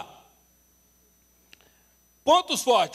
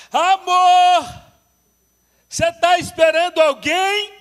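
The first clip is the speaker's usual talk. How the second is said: calling out; 10 semitones higher, 13 dB louder, slower than usual. Retold or imitated. Husband calling upstairs to wife.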